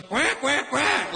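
high-pitched mock duck; duck quacking. Male duck challenging the other male, high quacking. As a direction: quack like a drake squaring up. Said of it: "He goes".

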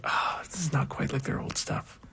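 hushed, half-whispered mock disappointment; retold letdown. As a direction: murmur it like a doubtful first reaction. Recalls own lukewarm first reaction.

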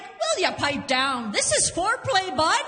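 mom voice. Retold or imitated. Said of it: "And she's like".

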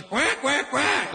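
high mock quacking challenge; puffed-up duck. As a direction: quack like a male duck squaring up. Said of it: "starts making himself look big".

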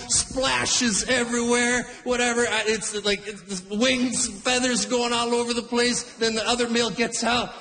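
ordinary delivery

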